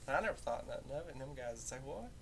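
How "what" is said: ordinary talk, nothing marked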